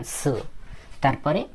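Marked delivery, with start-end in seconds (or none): 0.0-0.4 "su" said low and soft; low, quiet demonstration of "su"; model "su" pronunciation. Shown as a demonstration. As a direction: pronounce "su" softly for learners to copy. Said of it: "এটার মতো উচ্চারণ করবেন".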